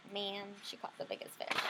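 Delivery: mild envious big brother mutter; disappointed mutter. Acted. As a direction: grumble it under your breath, let down. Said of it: "He's like".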